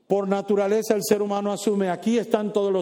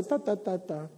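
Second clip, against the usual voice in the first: mumbled gossip; 7 dB quieter than usual. Acted. Mocking gossip.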